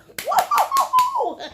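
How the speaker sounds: high, laughing woo-hoo-hoo; giddy high-pitched cheer; excited whoop. Real, not acted. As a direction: whoop it up in a string of hoots. Host whooping to live viewers.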